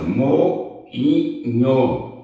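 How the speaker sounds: moinho as mo... i... nho; syllable by syllable. Shown as a demonstration. Teacher gives another NH example, moinho split into syllables. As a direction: give each syllable of moinho its own beat. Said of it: "Sozinho na sílaba tônica".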